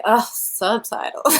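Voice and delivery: slow, groaning complaint; hammed-up reluctance, dragging the words; mock disgust. Mimics Americans disliking subtitles.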